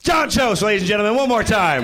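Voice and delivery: host announcement. Real, not acted.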